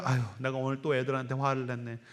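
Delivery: soft, sighing self-reproach; mild mock of the wife sighing at the sink; quiet lament. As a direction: murmur it to yourself over the dishes. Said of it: "속상해서 이제 설거지를 하면서".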